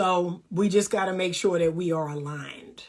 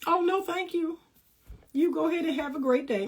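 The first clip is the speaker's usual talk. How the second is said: higher, sweet polite dismissal; polite brush-off; 6 semitones higher, 4 dB quieter than usual. Pretend. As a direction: turn down a suitor with fake sweetness, a bit faster. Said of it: "See, that's easy".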